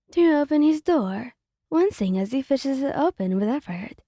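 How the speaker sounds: usual speaking voice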